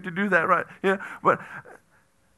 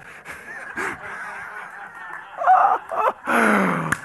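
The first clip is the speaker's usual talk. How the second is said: long helpless laugh; burst of laughter; 4 semitones higher than usual. Real, not acted. Preacher laughing after calling the goat the wrong name. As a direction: crack up at your own mistake.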